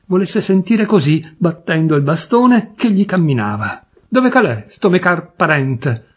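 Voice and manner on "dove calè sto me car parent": put-on Turin accent shout. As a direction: bawl it in broad Piedmontese Turin accent, drawn out at the end. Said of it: "si mise a gridare con stretto accento torinese".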